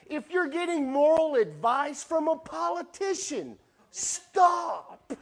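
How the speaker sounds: high, loud preaching build to a cry of stop; full-force preaching. Acted. Pastor warning the church about moral advice, rising and loud. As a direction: drive it home to the congregation.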